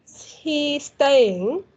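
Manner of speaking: "Is he staying" very slow with a rising end; question intonation. Shown as a demonstration. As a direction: draw out "Is he staying", lifting "staying" into a question. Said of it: "We have the question mark here".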